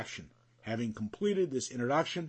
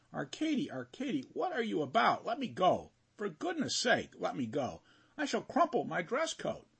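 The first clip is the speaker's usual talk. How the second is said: put-on higher, flustered plea from a young man pinned down; pleading Vasya; 6 semitones higher than usual. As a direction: beg your friend to let go, half laughing.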